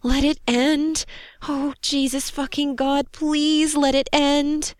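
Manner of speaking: high, slow desperate wail; moaned plea. A narrator's reading. Soldier swinging under fire begging for it to end, moaned plea. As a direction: draw out the begging as a moan. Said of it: "Joni moaned".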